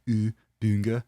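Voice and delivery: Bunga with a rounded, fronted "ü"; careful, experimental umlaut in Bunga; umlaut sound. Shown as a demonstration. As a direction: try out the umlaut U, then Bunga as "Bünga". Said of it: "I never really actually knew how to say that".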